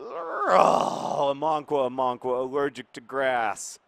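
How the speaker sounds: loud, high wail at a blunder; anguished cry. Real, not acted. Manager wailing at his goalkeeper's blunder. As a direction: cry out in dismay, then mourn it.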